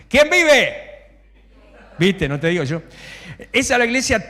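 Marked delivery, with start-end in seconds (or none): 0.1-0.7 high, loud rallying call; shouted call. Shown as a demonstration. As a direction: shout it to the congregation for a reply. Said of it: "y ahora me lo van a decir". (grito alto de llamada a la congregación)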